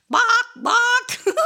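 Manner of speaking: high, loud, laughing bawk bawk; chicken noise. Pretend. Host imitates a chicken for the kiddos, high and laughing. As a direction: cluck it out like a silly hen, giggling. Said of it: "what do chickens say".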